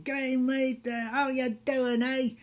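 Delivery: put-on loud, high Australian accent greeting; boisterous mock-Australian hello; outgoing Australian. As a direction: imitate a hearty Aussie businessman on the phone. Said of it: "Australian businessman".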